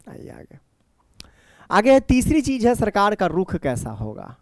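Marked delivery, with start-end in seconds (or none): 0.1-0.6 whispered aside. Real, not acted. Teacher moves to next point.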